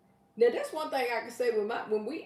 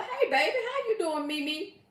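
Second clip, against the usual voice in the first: excited greeting; 7 semitones higher than usual. Real, not acted. Greeting viewer warmly.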